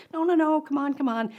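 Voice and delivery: high, loud eager coaxing; kids pleading. Retold or imitated. Adult children coaxing their mom, eager high pleading. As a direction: beg her to come along.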